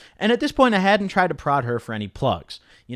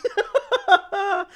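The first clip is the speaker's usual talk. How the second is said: high disbelieving laugh. Real, not acted. Disbelief at PCP request.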